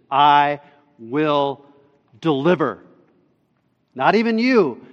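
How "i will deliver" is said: "I", pause, "will", pause, "deliver"; loud "I", then each word set apart; emphatic. Shown as a demonstration. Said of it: "receives some emphasis".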